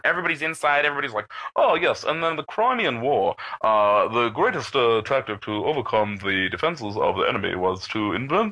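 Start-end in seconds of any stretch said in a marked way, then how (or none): 1.6-8.5 pretend-innocent lecture tone to fool the cops; fake scholarly chat. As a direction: put on a lofty history-buff voice.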